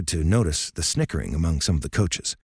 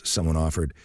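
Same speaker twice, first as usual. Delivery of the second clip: ordinary delivery, nothing marked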